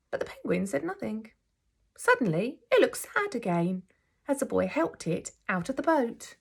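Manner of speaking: gentle, expressive storybook reading; teacher reading a picture book aloud to her class; reading to children. A narrator's reading. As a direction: read it like story time for little ones.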